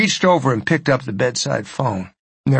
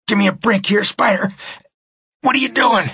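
fearful pleading; 7 semitones higher than usual. Acted. Pleading victim.

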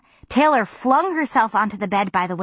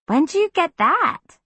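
trying not to sound jealous; 10 semitones higher, slower than usual. A narrator's reading. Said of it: "I tried not to sound jealous".

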